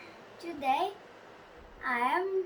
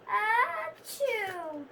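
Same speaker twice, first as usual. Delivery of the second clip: loud high achoo; acted sneeze; 6 semitones higher, 4 dB louder than usual. Gerald sneezes again while talking to Piggie. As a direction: let out a big sneeze.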